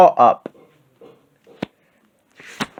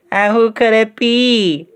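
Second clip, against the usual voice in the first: high, slow little cub's question; wee little voice; 8 semitones higher, slower than usual. A narrator's reading. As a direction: wonder it aloud in a tiny high voice. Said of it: "in his wee little voice".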